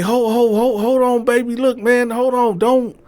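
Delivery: very high, loud, frantic; man panicking about the baby, very high; panicked boyfriend. Retold or imitated. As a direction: stammer in panic. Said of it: "He like".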